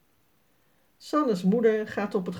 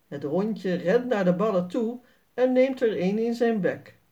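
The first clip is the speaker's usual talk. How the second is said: ordinary delivery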